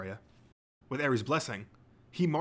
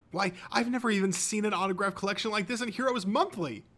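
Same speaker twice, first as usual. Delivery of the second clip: high, excited fan gush building louder; hero fanboy gushing; 9 semitones higher, 4 dB louder than usual. A narrator's reading. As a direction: reel off treasures with mounting awe. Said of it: "ever the hero fanboy".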